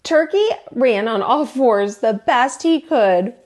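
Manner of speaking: ordinary delivery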